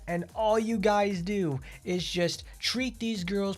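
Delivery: high, slow whiny outrage, stretched out; mocking angry rant. Streamer mocking an angry player's rant in a high slow whine. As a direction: whine like an offended fan crying about injustice. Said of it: "He's so pissed off at Gremory".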